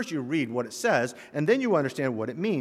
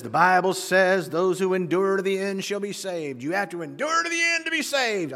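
mock a Southern preacher, pitched up and stretched out; Southern accent; 4 semitones higher than usual. Preacher imitating someone misusing a verse, Southern accent. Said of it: "Southern accent when I talk about someone like that".

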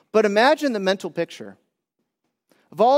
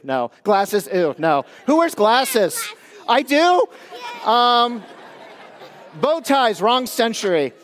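mock disciple gatekeeper; 5 semitones higher than usual. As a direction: play a snooty disciple shooing the children off. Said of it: "So as the disciples, that's me".

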